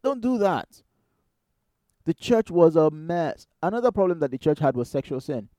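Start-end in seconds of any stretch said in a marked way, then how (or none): 0.0-0.8 high-pitched, drawn warning falling at the end; pleading rebuke. Retold or imitated. Paul warning the church about public lawsuits, pleading. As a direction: implore them to stop, pitched high. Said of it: "He was like".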